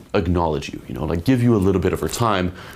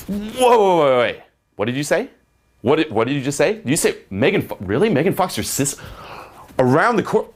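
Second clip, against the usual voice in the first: excited; 7 semitones higher than usual. Shown as a demonstration.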